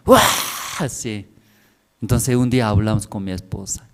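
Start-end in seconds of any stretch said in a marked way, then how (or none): 0.1-0.9 high, drawn-out "uah" yell; short burst of mock shouting; imitated yell. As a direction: mimic a parent hollering. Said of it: "Solo grita".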